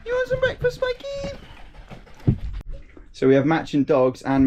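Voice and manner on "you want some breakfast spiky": very high cat-coaxing voice; squeaky singsong question; doting pet talk. Real, not acted. Asks the cat about breakfast.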